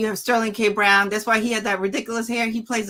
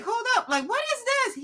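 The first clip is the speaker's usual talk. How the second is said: shocked agent; 14 semitones higher, faster than usual. Retold or imitated. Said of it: "his agent's like".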